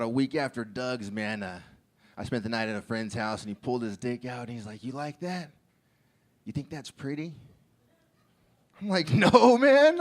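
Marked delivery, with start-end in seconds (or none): 4.8-7.3 creepy soft come-on. Retold or imitated. Friend voiced in a story. 9.1-10.0 startled loud no. Retold or imitated. Startled refusal in retold scene.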